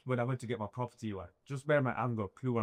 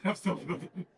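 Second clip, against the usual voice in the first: laughing; 6 semitones higher than usual. Real, not acted. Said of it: "So I'm still building".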